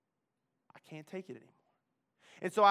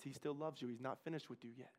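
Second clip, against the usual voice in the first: much softer, lower gentle voice; soft inner voice speaking to him; 5 semitones lower, 9 dB quieter than usual. Retold or imitated. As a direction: speak it gently like a comforting whisper. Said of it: "this soft voice on his shoulder".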